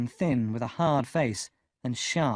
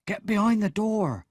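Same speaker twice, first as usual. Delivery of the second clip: higher, hurried warning to hide; urgent Joe; 9 semitones higher, 3 dB louder than usual. A narrator's reading. Joe telling Pip to hide, urgent. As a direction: hiss it to the boy quickly.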